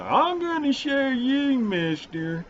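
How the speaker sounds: drawn-out, high, hammed-up Southern boast; arrogant know-it-all. Host mocking how he might come off to viewers, arrogant put-on voice. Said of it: "I don't wanna come off arrogant".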